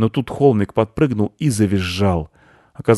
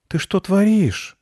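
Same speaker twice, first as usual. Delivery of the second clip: high, drawn-out disbelief; boy's high startled question; astonished; 13 semitones higher, slower than usual. A narrator's reading. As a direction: question the mess in slow amazement. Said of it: "удивился мальчик".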